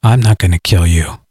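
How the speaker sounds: low, cold, measured voice; low cold hero. Acted. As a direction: deliver it like a cold killer. Hero calmly threatens the hillbilly.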